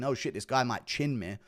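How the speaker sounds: a low murmured worry; hushed inner thought. Retold or imitated. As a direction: think it to yourself quietly. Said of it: "now he's thinking".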